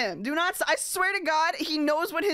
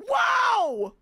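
surprised shout; 8 semitones higher, 5 dB louder than usual. Real, not acted. Sudden exclamation amid laughter.

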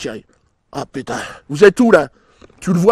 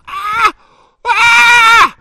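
high, loud gasping scream; panicked scream; 6 dB louder than usual. Pretend. Mock panic, can't breathe.

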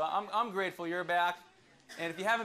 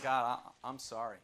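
lower, much softer broken confession; contrite Jonah; 8 semitones lower, 4 dB quieter than usual. Retold or imitated. Jonah confessing to God at rock bottom, contrite and soft. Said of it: "He's like".

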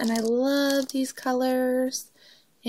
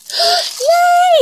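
sharp high gasp of delight; 11 dB louder than usual. Real, not acted. Opening a friend's package, delighted gasp. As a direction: gasp with surprise at the gift.

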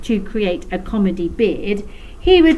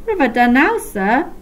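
smirking reply; 5 semitones higher, 4 dB louder than usual. A narrator's reading. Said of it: "replied Bog with a smirk".

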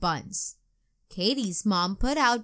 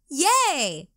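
loud, high little cheer; a kid cheering that the rain stopped; 5 semitones higher, 5 dB louder than usual. A narrator's reading. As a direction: cheer with delight. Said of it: "cheered Katie".

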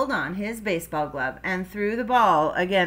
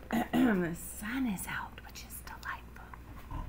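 whispered aside; 9 dB quieter than usual. Real, not acted. Reader tells viewers about the sun, whispering. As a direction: slip in an aside under your breath.

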